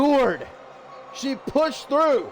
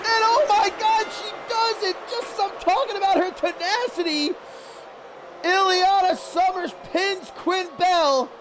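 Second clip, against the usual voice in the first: high-pitched, amazed play-by-play; excited wrestling commentator, voice raised high; astonished victory call; 9 semitones higher than usual. Real, not acted. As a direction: erupt at the surprise finish. Calling the surprise match finish.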